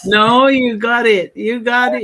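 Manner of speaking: high, loud and slow, "you got it"; excited reassurance. Real, not acted. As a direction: eagerly reassure the host. Host loudly reassures at the close.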